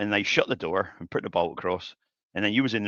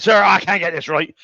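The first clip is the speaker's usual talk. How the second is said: louder, higher fake plea for help; mock helpless plea; 9 semitones higher, 10 dB louder than usual. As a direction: ham up the helpless apprentice. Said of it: "I said".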